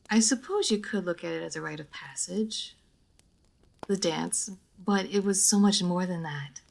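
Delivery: ordinary talk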